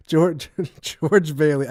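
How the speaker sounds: breaking into laughter mid-line; can't keep a straight face; laughing. Retold or imitated. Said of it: "I can't do it without laughing".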